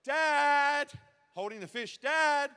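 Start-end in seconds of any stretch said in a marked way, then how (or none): 0.0-0.9 high, loud call; yelling for dad. Retold or imitated. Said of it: "I just kept yelling". 2.0-2.5 high, loud call; yelling for dad. Retold or imitated. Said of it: "I just kept yelling".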